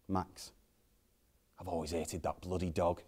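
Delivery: quiet, resentful deadpan; bitter aside. Acted. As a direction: say it under your breath with loathing. Bitter aside about mum's dog.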